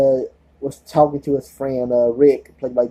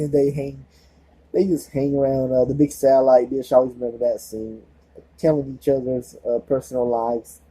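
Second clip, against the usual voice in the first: ordinary talk, nothing marked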